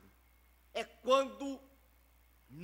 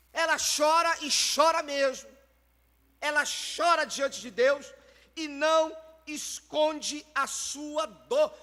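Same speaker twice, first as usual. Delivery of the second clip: building intensity; 4 semitones higher, 7 dB louder than usual. Real, not acted. Preacher rising in intensity.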